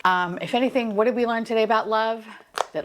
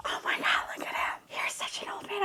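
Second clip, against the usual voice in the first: awed whisper; 10 semitones higher, 10 dB quieter than usual. Real, not acted. Collector gazing at the old man figure, adoring whisper. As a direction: breath-only, overcome whisper.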